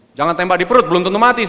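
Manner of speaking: worked-up, high-pitched challenge; heated dare. Retold or imitated. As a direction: act out a husband daring his wife in a row. Acted quarrel, daring wife to shoot.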